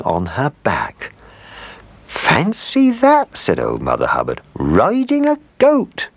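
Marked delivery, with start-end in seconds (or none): 2.1-3.4 very high, slow, drawn-out amazement; amazed. A narrator's reading. Old woman sees dog riding a goat, high amazement. 4.5-6.1 slow, high-pitched swoop of astonishment; amazed. A narrator's reading. Old woman exclaims at her dog's feat, high and amazed. Woman sees dog riding goat.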